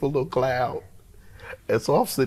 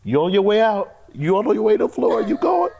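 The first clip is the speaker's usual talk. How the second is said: higher, louder, rising panic; frantic inner voice; 11 semitones higher, 7 dB louder than usual. Retold or imitated. Fainting in church, telling himself he's going down, high and urgent. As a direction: warn yourself you're about to faint. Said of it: "I'm telling myself in my head".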